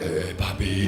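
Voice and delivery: imitating a low-voiced, downmarket hooker; affordable streetwalker. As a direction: drop way down for a cut-price greeting. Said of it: "a little bit more affordable".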